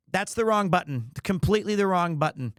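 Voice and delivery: normal talking voice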